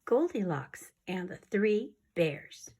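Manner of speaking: soft, hushed storytelling whisper; whispered story reading. Acted. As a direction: read the tale in a secret whisper.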